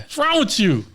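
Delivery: high, loud irritated outburst; angry. Retold or imitated. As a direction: snap at the people blocking you. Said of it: "I'm so mad".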